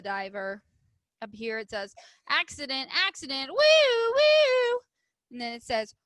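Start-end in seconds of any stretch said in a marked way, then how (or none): 2.3-4.8 high, loud woo woo like a siren; siren sound. A narrator's reading. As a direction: wail like an ambulance. Siren in the picture.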